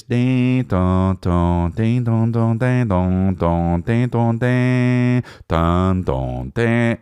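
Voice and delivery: sing-song imitation of a bell ringing; ding dong ringing. Actor chiming a bell tune down the phone.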